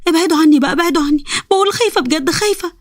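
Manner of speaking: high frightened plea fading to a whimper; screaming in fear. Acted. Sara under the blanket crying at the jinn, high then whimpering. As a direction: cry them away, then whimper. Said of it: "وعمالة تصوت بجد".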